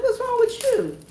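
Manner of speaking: high, squeaky, innocent question; mock concern. Asking her mad husband, high mock-innocent squeak. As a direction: play it wide-eyed innocent.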